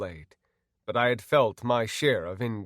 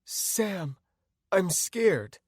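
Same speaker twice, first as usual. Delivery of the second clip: high, frightened woman's voice; high, scared whimper; scared, tearful; 8 semitones higher, much slower than usual. Acted. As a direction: sound close to tears with fear. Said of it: "began crying like I had never heard".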